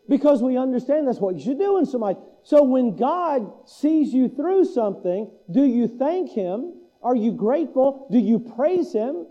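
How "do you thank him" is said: normal talking voice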